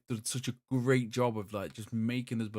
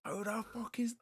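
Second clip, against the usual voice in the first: retold angry scream; 10 semitones higher, 5 dB quieter than usual. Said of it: "Screaming to these next people".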